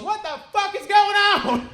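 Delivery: high, booming alarmed yell; slow, full-force shout of disbelief; Ralphie. Retold or imitated. Tour headliner reacts to a panicking comic, imitated high yell. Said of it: "that's when Ralphie goes".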